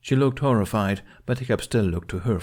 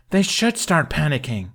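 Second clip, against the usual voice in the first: higher, louder frustrated yell; 8 semitones higher, 5 dB louder than usual. A narrator's reading. Hiccup yelling back at his father, frustrated. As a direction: shout over his father's objection. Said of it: "Hiccup yelled".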